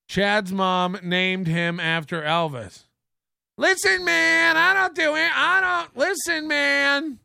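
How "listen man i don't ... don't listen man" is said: mock streamer denial. Streamer denying screaming, high defensive voice. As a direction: protest it like the streamer.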